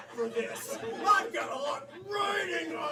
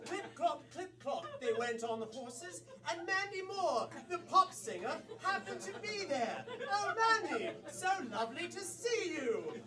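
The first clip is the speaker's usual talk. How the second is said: theatrical British storyteller; stage British accent; 5 dB quieter than usual. Acted. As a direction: swoop through it in a put-on British accent.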